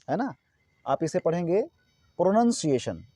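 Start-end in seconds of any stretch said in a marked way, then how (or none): 2.2-3.0 "प्रोनाउंसिएशन" with a short 'nun' in the middle; correct pronunciation. Shown as a demonstration. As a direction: say "प्रोनाउंसिएशन" as pro-nun-see-AY-shun. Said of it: "यानी right क्या है". ("प्रोनाउंसिएशन" में 'नाउन' नहीं, छोटा 'नन' बोलें)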